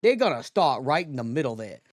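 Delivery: loud, exaggerated New York accent; almost New York accent. Shown as a demonstration. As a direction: demo a weird New York accent, punched hard. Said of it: "almost New York accent".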